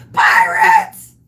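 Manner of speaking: high, loud cry of pirates; yelling pirates. Retold or imitated. On the boat, shouting to the charter guide, high mock alarm yell. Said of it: "So I yell out".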